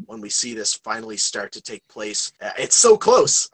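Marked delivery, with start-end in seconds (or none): 2.6-3.5 high, loud, drawn-out excitement; excited anticipation. Real, not acted. Host to co-host about the coming episodes, excited. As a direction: burst out with eager impatience.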